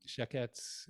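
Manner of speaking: sheketz said slowly and carefully as Hebrew; Hebrew word. Shown as a demonstration. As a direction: spell out sheketz slowly for the class. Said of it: "The word in Hebrew is sheketz".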